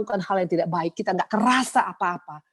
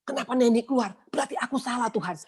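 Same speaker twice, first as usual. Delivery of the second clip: dismayed realization; 6 semitones higher than usual. Retold or imitated. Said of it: "saya baru sadar".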